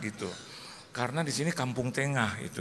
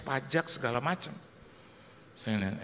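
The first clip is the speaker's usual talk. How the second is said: normal talking voice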